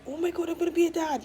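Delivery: mock-imitating husband's joyful shock; excited husband. Husband realizes he's a dad.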